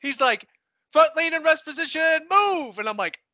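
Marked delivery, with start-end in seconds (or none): 0.9-2.8 high, loud shouted order; imitating a drill sergeant shouting orders; drill sergeant yell. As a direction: yell the command at the hiding private.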